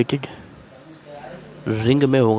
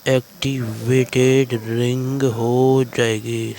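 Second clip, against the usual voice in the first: slow emphasis; much slower than usual. Shown as a demonstration. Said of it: "तो ये हमारा क्या हो जाएगा भाई".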